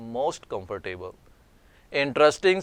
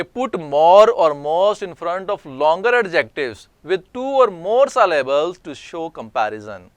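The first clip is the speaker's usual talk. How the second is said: slow, deliberate dictation of a grammar rule; slow rule reading; 4 semitones higher, 6 dB louder, much slower than usual. Acted. Teacher slowly reading an English rule to students, dictation pace. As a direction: read the rule word by word for students.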